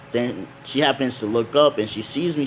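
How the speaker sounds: ordinary delivery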